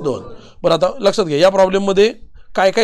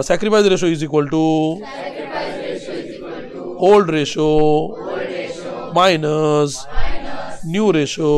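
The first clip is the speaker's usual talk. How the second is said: stretched words with long gaps; slow dictation; 2 semitones lower, much slower than usual. Real, not acted. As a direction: dictate it piece by piece, drawing out each ending. Dictating formula for class to repeat. (सावकाश, शब्द लांबवत लिहून देणे)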